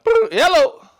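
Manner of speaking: high, loud phone hello; old man's loud hello; granddaddy answering phone. Retold or imitated. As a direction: pick up the phone like an old man.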